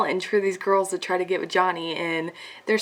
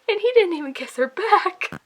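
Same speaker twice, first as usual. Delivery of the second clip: high, loud exasperated outburst; going crazy; 9 semitones higher, 7 dB louder than usual. Real, not acted. Reader vents about a one-sided kiss after hundreds of pages, exasperated. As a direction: wail it in fan frustration. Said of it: "and I'm going crazy".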